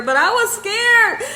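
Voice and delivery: high-pitched mock-scared exclamation; playful exaggerated fear; comic retold fright. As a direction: laugh at your own fear, voice jumping up. Retelling her fear, laughing at herself.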